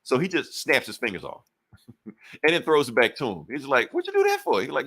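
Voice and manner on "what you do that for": squeaky high outrage; cartoonish high complaint; high indignant voice. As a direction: pitch way up in disbelief. Character's indignant quote.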